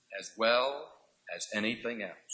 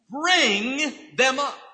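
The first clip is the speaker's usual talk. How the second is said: "bring them up" slow, higher and louder; stress on bring; 6 semitones higher, 4 dB louder, much slower than usual. Shown as a demonstration. Preacher urging fathers to raise their children, slow and emphatic. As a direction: lean hard on "bring". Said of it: "Now notice he says".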